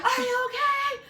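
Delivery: very high, loud, drawn-out squeal; piercing singsong shout; mock concerned call. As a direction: imitate someone hollering over in exaggerated concern. Imitates someone calling to her fallen.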